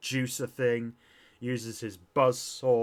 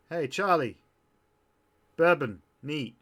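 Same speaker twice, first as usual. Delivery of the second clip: raised voice, a bit higher, not a full shout; mild yell; 3 semitones higher, 5 dB louder than usual. Acted. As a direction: call out an order to the bartender. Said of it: "She yells to Charlie".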